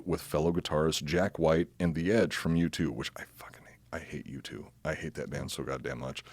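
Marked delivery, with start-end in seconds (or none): 2.9-6.3 low muttered loathing; quiet, bitter venom; hushed hate. Real, not acted. Venting hatred of U2.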